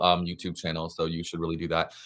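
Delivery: usual speaking voice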